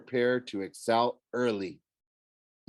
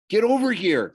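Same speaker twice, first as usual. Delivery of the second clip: high, loud urgent command; urgent order; 10 semitones higher, 7 dB louder than usual. A narrator's reading. Girl in hiding calls her friend over, urgent. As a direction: call it out sharply, pitched way up.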